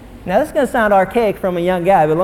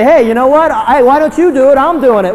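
high, loud mock complaint; hammed-up exasperated wife; mock nagging wife; 8 semitones higher, 7 dB louder than usual. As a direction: imitate a wife scolding her husband. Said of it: "you say".

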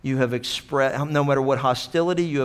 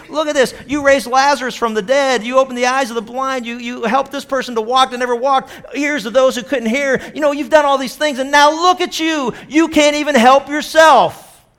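much higher, louder taunting jeer building; crowd mocking; 10 semitones higher, 7 dB louder than usual. Preacher voicing the crowd mocking Jesus on the cross, loud and scornful. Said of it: "They laughed at how he saved others".

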